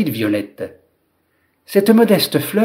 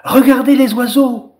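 excited high cry, a little louder; narrated children's shout; 9 semitones higher, 4 dB louder than usual. A narrator's reading. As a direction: call out high, pointing something out to everyone. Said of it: "les enfants poussèrent des cris".

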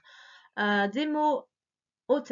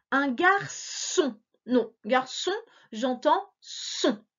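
usual speaking voice

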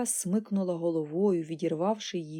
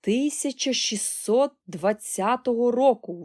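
loud, high, drawn-out cry; astonished exclamation; 4 semitones higher, 6 dB louder, slower than usual. A narrator's reading. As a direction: blurt the year out in excitement. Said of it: "вигукнув Чарлі".

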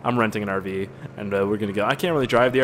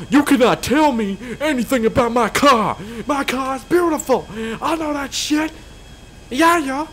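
much higher, slow, autotune-style rap voice; 13 semitones higher, 5 dB louder, slower than usual. Retold or imitated.

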